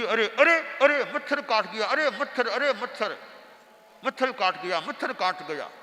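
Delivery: fussy yelp. Acted. Bitten guru yelps at the mosquito, comically startled. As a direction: fuss loudly over a tiny bite.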